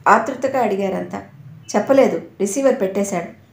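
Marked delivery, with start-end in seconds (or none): none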